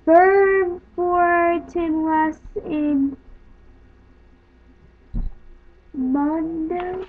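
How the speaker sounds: "Verbortenwas in Mundo" sounded out very slowly, syllable by syllable; slow careful sounding out. Shown as a demonstration. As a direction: drag out "Verbortenwas in Mundo" word by word. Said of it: "I'll show you it first".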